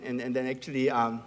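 normal talking voice

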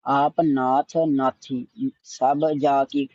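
ਨਾਥੀ slightly firm, whole line slow; slow, a little tight on ਨਾਥੀ, not too much; slightly tight naathi. Shown as a demonstration. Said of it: "ਨਾਥੀ ਥੋੜਾ ਕੁ ਕੱਸ ਕੇ". (ਨਾਥੀ ਥੋੜਾ ਕੱਸ ਕੇ, ਹੌਲੀ)

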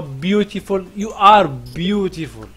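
normal talking voice

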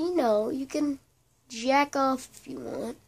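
ordinary talk

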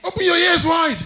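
very high, loud shout; high-pitched preacher's yell; shouted exhortation. Real, not acted. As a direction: holler it at the congregation as a command. Repeats the retort as a shout.

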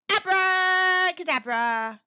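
a witch calling out her magic word; magic spell shout. Acted. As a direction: cast the spell with a grand flourish.

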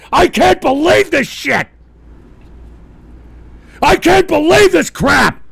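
high, exasperated disbelief; outraged yelling. Real, not acted. Host fed up with listeners, outraged yelling. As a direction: yell it, punching believe.

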